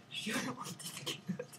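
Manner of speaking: hushed, voiceless confession; whispered aside. Real, not acted. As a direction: drop to a whisper after the outburst. Embarrassed aside after loud protest. (aparté chuchoté)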